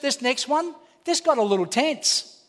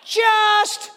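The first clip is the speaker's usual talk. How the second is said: shouted countdown, higher and louder, the last count drawn out slow; mock shouting parent; 7 semitones higher, 6 dB louder, much slower than usual. Preacher mocking a parent who never follows through, hammed-up shouting. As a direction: yell the count, then stall on two and a half.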